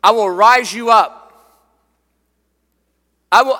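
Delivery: loud, emphatic preacher's declaration; exalting proclamation. Acted. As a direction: ring it out as praise to God. Voicing David exalting God.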